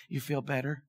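soft, low question; gentle check-in. Retold or imitated. God beside him on the couch, quiet retold reassurance. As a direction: ask it quietly, as if sitting right beside them.

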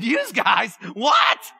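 loud incredulous repeat of a New York phrase; mock disbelief. Pastor teasing a New York visitor about saying yous guys, loudly incredulous. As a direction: echo him back in disbelief. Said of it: "I said".

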